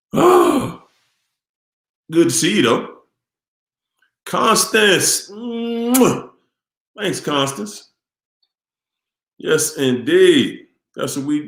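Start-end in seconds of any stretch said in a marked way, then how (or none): 0.1-0.8 surprised oh. Real, not acted. Greets a viewer joining the stream. 9.4-10.7 a slow, relishing yes, indeed; slow drawn-out catchphrase. Real, not acted. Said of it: "his signature phrase, drawn out".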